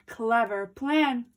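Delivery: usual speaking voice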